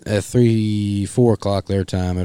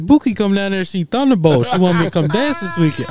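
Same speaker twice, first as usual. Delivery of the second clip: high, louder mock caller voice; mimicking a caller; 11 semitones higher, 5 dB louder than usual. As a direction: pitch it up, teasing. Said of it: "He said".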